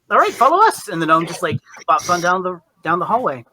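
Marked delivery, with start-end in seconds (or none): 0.1-0.9 much higher, louder call. Acted. Gnome calling the robot to follow, much higher and louder. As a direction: call them along, up high and loud. Gnome calls the newcomer along.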